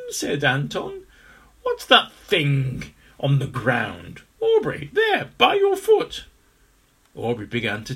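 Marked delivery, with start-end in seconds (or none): 1.6-6.3 slow, then high and urgent; alarmed pointing. Acted. Character spots strange object.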